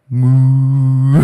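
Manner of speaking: loud bellowing moo; minotaur moo. Pretend. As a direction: moo like a bull. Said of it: "Do a Minotaur impression".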